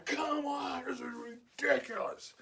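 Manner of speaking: high, dragged-out yell of disbelief; mock exasperated shout. Old guy yelling as another item is scanned, imitated exasperated shout. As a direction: holler it slowly like a fed-up old man. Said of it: "I hear the guy".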